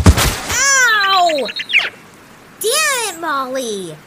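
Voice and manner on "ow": a loud, high yelp of pain; sharp cry of pain. Real, not acted. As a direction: yelp as if you just got hurt. Girl hurt on broken board.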